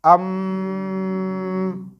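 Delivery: अम held long as a nasal hum; nasal ghunna. Shown as a demonstration. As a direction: draw out अम with lips closed and the sound in the nose. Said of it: "इसी तरह".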